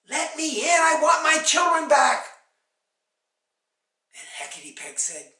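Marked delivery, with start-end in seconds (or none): 0.0-2.2 high, loud shout through the door; furious mother's holler; angry demand. A narrator's reading. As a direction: demand your children back at full voice. Angry mother demands children back.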